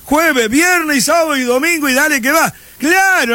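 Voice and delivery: ordinary delivery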